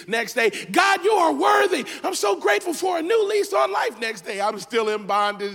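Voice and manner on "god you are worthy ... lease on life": ecstatic praise. Acted. Worshipper on a high day.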